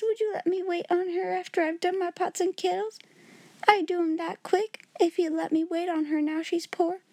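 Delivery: high, timid maid voice; a frightened little servant girl pleading; Becky's voice. A narrator's reading. Becky begging to serve Sarah.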